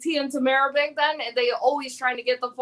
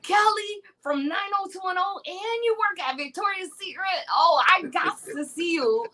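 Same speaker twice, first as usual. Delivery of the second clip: high-pitched imitation of an excited guy; all worked up; 7 semitones higher than usual. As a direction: mimic a chat-line guy getting carried away. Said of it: "He was getting all worked up".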